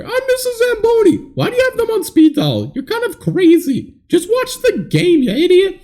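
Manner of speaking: shrill-free high mock old lady scolding. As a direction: squeak it out like a cranky granny. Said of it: "Or, sorry".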